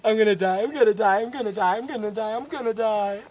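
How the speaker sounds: panicked, repeated high chant; hammed-up trembling panic; shaking with dread. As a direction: babble it in mock terror. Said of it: "I'm just shaking".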